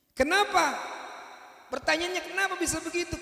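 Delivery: preacher challenging the congregation; rhetorical question. Real, not acted. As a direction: challenge the crowd.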